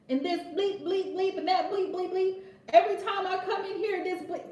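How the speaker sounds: a hint of a ranting father, bleeped; mock cursing dad. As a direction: lampoon a dad swearing, bleeping himself. Mocking a cursing man.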